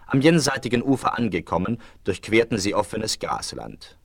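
usual speaking voice

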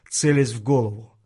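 usual speaking voice

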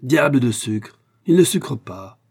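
plus fort et plus aigu, agacé; râler contre le sucre; colère. A narrator's reading. Mari irrité par le sucre. (le mari peste contre le sucre, en colère)

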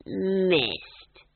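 a self-satisfied "Missed"; smug. Acted. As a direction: gloat over the miss. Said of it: "he said smugly".

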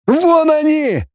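very high, drawn-out holler; pursuer's high cry on sighting the quarry; calling out. Acted. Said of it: "загласил сзади рыжий". (протяжный высокий крик)

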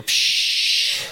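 slow whispered psch; shushing hiss. Retold or imitated. Kid stealing from father's wallet, whispered shush. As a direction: hush them in the dark.

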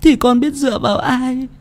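put-on high, whimpering girl on the verge of sobs; tearful pleading. A narrator's reading. Frightened granddaughter begging her delirious grandmother, tearfully pleading. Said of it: "rồi mếu máo".

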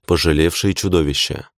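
usual speaking voice

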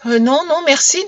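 put-on high child's voice; timid boy. A narrator's reading. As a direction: politely decline in a small boy's voice. Timid boy answers kindly peasant.

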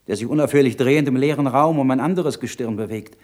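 put-on a young monk crying out for his poor parents; impassioned outcry. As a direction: let the anguish build into a cry. Monk's rising impassioned outburst.

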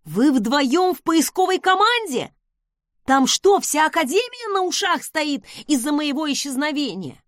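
high, loud, wide-eyed questioning; naive amazement. A narrator's reading. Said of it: "И наивно спросил".

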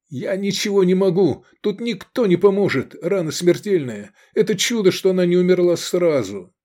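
high, agitated protest; desperate helplessness. A narrator's reading. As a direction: insist in anguish that nothing can help. Helpless outburst beside dying girl.

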